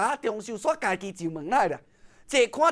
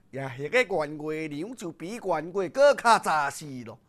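ordinary delivery, nothing marked

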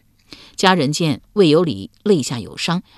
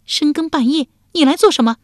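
high, loud startled demand; loud question; 10 semitones higher, 3 dB louder than usual. A narrator's reading. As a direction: call out sharply in alarm. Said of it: "大声问道".

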